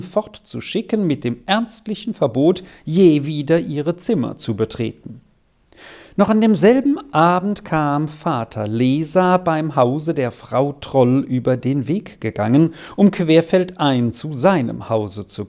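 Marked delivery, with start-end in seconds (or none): none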